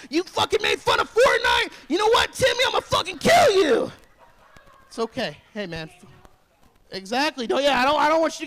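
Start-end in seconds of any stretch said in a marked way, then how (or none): 0.1-3.9 high screaming rage, much louder; mock school-shooter tantrum, yelled; enraged kid. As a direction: shriek it like a furious gamer kid. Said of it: "I don't want him to be able to say".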